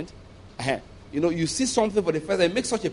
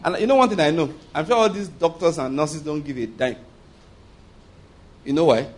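ordinary talk

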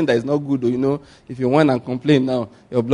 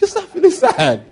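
mocking laugh. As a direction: tease the gloom with a laugh. Mocking the broke person's mood.

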